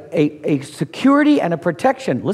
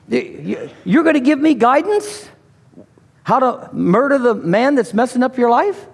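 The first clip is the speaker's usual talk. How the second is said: mock disbelief; 6 semitones higher than usual. Mock challenging David's advice.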